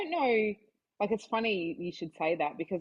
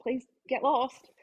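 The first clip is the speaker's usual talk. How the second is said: raised-pitch, acted brush-off; mock exasperation; 5 semitones higher, 6 dB louder than usual. People approach me on a date, mock exasperated brush-off. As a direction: act out shooing away an intruder. Acts out reply to date interrupters.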